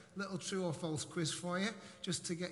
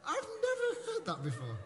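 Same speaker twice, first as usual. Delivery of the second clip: laughing in disbelief. Real, not acted. Surprised at congregant's knowledge.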